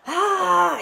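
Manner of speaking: loud, high re-enacted howl of pain; retold howl. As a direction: act out a sudden high "Ah" howl. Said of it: "you know, I howled".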